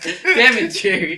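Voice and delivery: very loud, high mock curse; mock angry. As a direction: yell it loud in mock anger. Quoting angry fisherman.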